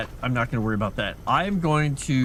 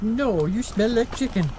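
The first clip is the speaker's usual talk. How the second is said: lightly higher cat voice; a hint of a cat talking back; voicing the cat; 8 semitones higher than usual. Cat answering owner, lightly voiced higher. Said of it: "He's like".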